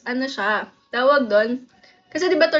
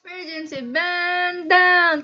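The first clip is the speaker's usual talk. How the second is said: higher, drawn-out chant; drill command style; 4 semitones higher, much slower than usual. Shown as a demonstration. Showing how the drill must be said, drawn-out call. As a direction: stretch BAND and DOWN like a cabin call. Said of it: "Kailangan ganun yung pagkakasabi".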